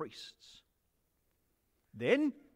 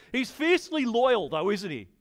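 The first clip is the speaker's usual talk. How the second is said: usual speaking voice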